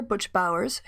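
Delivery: normal talking voice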